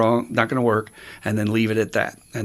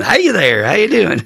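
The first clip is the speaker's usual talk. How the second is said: put-on bright, high and friendly old timer; a lightly voiced chirpy old man saying hello; cheery greeting; 8 semitones higher, 6 dB louder than usual. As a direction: greet him like an overly chipper old-timer. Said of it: "and he's going".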